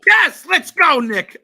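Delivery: high, loud rallying yell; excited yell. Real, not acted. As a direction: answer the cheer at full voice. Host answers the hype.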